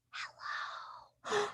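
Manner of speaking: tiny whispered hello. Pretend. Puppet Henry greets the kids, soft whisper. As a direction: barely breathe the hello. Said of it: "say hello as quiet as we can".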